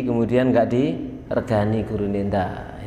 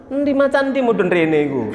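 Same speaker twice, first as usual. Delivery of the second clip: mock scary parent; 9 semitones higher than usual. Parent scaring crying child with tiger.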